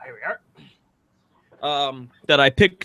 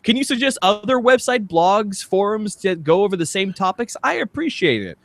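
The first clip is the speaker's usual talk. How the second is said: light, chirpy spam bot voice, a touch higher; spam bot reading; 7 semitones higher than usual. Acted. Podcast host reads a spam bot comment aloud, mock polite. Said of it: "a comment from a newbie spam bot".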